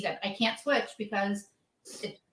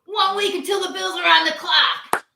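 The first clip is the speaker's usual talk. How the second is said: announcer read, louder and higher; Bob voice; 6 semitones higher, 6 dB louder than usual. Acted. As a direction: boom it out like a football announcer. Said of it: "That actually sounded like Bob".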